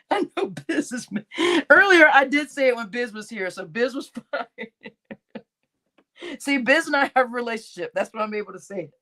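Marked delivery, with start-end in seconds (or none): none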